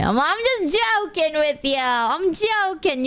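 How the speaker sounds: drawn-out, high, playful put-on voice; teasing backpedal. Streamer telling flustered friend she's joking, exaggerated playful drawl. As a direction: stretch it out in a silly high tease.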